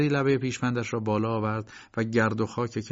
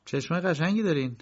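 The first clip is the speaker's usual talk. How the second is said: flirty woman; 7 semitones higher than usual. Acted. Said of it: "زن گفت".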